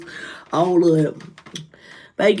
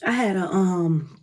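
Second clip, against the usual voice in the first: ordinary talk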